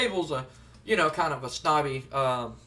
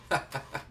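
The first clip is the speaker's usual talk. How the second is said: low, quiet mock laugh; imitated chuckle; 4 semitones lower, 6 dB quieter than usual. Boss laughing once wrestler has left, sly chuckle. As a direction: chuckle slyly to yourself in character. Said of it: "Shane laughs and goes".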